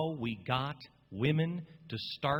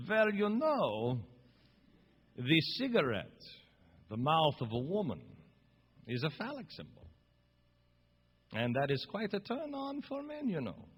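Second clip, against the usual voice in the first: put-on accent for Freud. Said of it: "Is your George Soros voice?".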